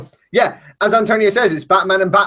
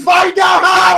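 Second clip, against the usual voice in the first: high, slow frantic yell; shouting; 13 semitones higher, 6 dB louder, slower than usual. Real, not acted. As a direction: yell it in a panic. Yelling about the earphone.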